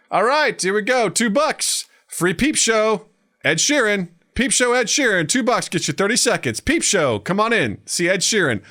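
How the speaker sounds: high-pitched sing-out sales patter; street barker. Retold or imitated. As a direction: hawk it to passers-by. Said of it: "this guy standing up on the street".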